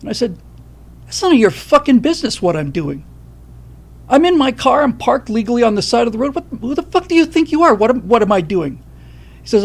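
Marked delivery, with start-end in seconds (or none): 1.0-8.8 raised, louder furious comeback; angry retort. Retold or imitated. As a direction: snap back at him, outraged and higher. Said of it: "I dropped all the F-bombs because I was so fucking pissed off".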